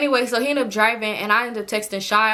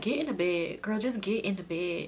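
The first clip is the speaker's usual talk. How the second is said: much softer murmured invite; hushed urging; 3 semitones lower, 10 dB quieter than usual. Retold or imitated. Drunk friend whispering to come under the covers, hushed. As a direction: quietly wave her into the bed.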